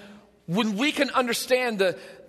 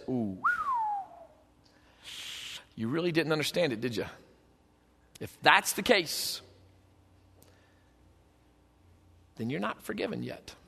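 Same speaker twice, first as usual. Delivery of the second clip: low, soft, long heavy pauses; grave disappointment; 6 semitones lower, 9 dB quieter than usual. Acted. God answering those who won't forgive, grave and quiet. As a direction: deliver the verdict quietly, letting it sink. Acting God's grave reply.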